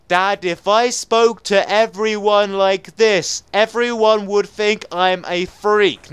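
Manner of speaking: slow, loud, drawn-out words. Shown as a demonstration. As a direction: spell it out at half speed for dad.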